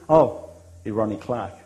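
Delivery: imitating Tana. Tana answering the luncheon question, slow and offhand. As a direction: drop into Tana's laid-back answer.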